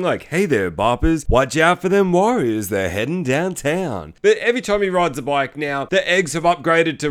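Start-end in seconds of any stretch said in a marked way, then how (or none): 0.2-4.1 slow, smooth late-night radio announcer; imitated movie radio host, drawn out. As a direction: drawl it out like a DJ tipping off listeners. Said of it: "a radio voice that is basically like the Warriors".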